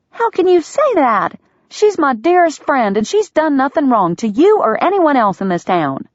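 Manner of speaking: indignant outburst. A narrator's reading. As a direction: defend her friend, outraged. Delia hurt and indignant.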